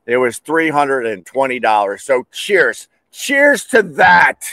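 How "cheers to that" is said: high, slow drawn-out toast; mock toast. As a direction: call it out as a sardonic toast. Sarcastic toast to the overcharge.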